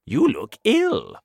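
high, loud concerned grasshopper. Acted. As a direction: ask it high and worried. Grasshopper continues.

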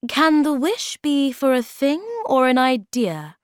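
motherly and curious; curious Moomin Mama. Acted.

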